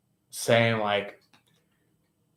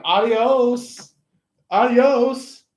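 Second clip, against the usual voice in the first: high, louder sing-song dismissal; breezy goodbye; 10 semitones higher, 4 dB louder, much slower than usual. Pretend. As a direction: wave them off cheerfully. Aquarius dismissing third party.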